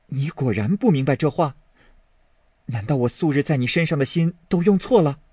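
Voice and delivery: put-on urgent young man pleading his heart; Baoyu, earnest. Baoyu baring his heart to Daiyu, earnest and urgent. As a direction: plead with her, hurt she doubts him.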